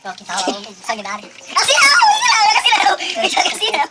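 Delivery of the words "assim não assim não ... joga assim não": loud yelping no, no; shouting protest. Real, not acted. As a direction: yell stop as cold water hits. Ice water poured on him.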